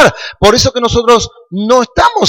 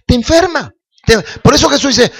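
usual speaking voice

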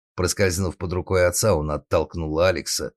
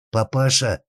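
higher, pleading cry to Father; plaintive moan; 8 semitones higher, 5 dB louder than usual. Acted. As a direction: whimper for mercy. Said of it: "снова жалобно простонал сынок".

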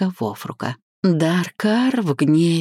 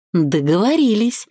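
higher, cheerful acceptance; pleased, pitched-up "deal"; beaming agreement; 5 semitones higher than usual. A narrator's reading. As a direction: agree with a radiant smile. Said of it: "просияла она лучезарной улыбкой".

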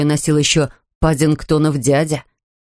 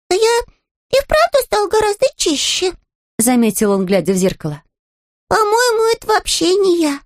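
bear cub voice; 13 semitones higher than usual. Acted. Bear speaking.

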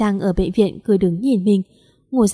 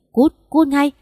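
mild angry dismissal, pitched higher; angry order; 5 semitones higher, slower than usual. A narrator's reading. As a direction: order him out, voice raised in pitch. Lâm orders the intruder out. (quát đuổi đi, hơi gắt)

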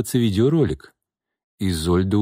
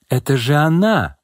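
higher-pitched cry of recognition; exclamation; 6 semitones higher, 3 dB louder than usual. A narrator's reading. Onlooker recognises the woman who walked in, exclaiming. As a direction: exclaim it with a high swell. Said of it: "воскликнула Белохвостикова".

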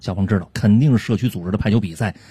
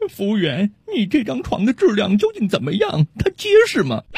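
high-pitched customer quizzing the sales clerk; high, questioning lady's voice; 8 semitones higher than usual. Acted. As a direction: play a fussy shopper, pitch shooting up on the last question. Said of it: "一位特别胖的女士问销售人员".

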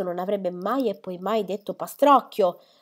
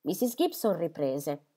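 ordinary delivery

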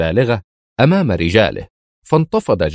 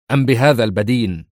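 plain reading voice

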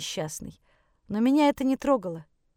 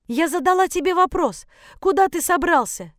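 high, loud agitated demand; stern demand; 9 semitones higher, 5 dB louder than usual. Acted. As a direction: demand an answer, voice raised high. Mother confronts son as he leaves. (требовательно, на повышенных тонах)

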